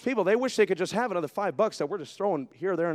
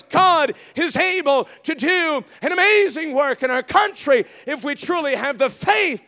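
loud, high, building preacher's cry; full-force preaching; 10 semitones higher, 11 dB louder, slower than usual. Acted. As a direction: preach it out to the rafters.

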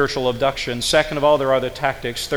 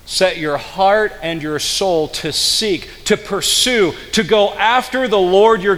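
slow restated verse; 4 semitones higher, 3 dB louder, slower than usual. Shown as a demonstration. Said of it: "Now set your heart".

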